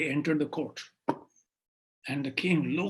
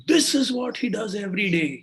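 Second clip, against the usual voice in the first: higher, louder, ringing declaration; emphatic proclamation; 5 semitones higher, 5 dB louder than usual. Acted. Child repeating his answer to the confused king, emphatic. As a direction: proclaim it forcefully, falling at the end.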